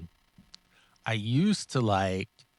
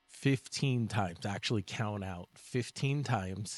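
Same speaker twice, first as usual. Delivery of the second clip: slow, deliberate instruction; slow emphatic count; 5 dB quieter, slower than usual. Shown as a demonstration. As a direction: stretch it out to make the point. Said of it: "So chew".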